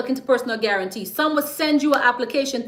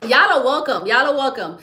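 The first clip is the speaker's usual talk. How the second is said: ordinary talk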